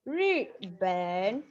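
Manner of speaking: "Riben": Ri falling sharply, ben dipping low; fourth and third tone. Shown as a demonstration. Teacher drilling the class on tones, careful model. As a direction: stress the tones of "Riben" clearly. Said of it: "It is the fourth tone and the third tone".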